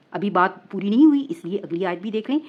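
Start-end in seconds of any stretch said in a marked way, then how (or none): none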